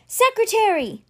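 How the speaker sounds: very high, falling holler; hollered summons. A narrator's reading. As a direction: yell for someone out of earshot. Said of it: "hollered once again".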